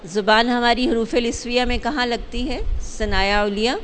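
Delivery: normal talking voice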